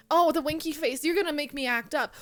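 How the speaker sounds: usual speaking voice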